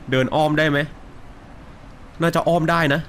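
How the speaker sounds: ordinary delivery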